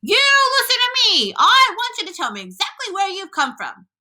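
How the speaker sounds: high-pitched official demand through cupped hands; shouted demand. A narrator's reading. Police chief questions the centipede from below, shouting. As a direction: call it up high, demanding an answer. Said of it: "shouted the chief of police".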